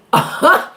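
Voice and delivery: a high, airless giggle; a squeaky laugh at the greeting; high breathless laugh. Real, not acted. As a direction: laugh in a burst with no voice. A laugh without words.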